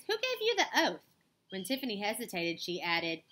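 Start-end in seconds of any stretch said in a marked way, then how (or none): 0.1-1.0 high, inquisitive question; suddenly curious. A narrator's reading. Annabelle questions new friend Tiffany, suddenly curious. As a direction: ask it with a sudden leap of interest. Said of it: "suddenly curious".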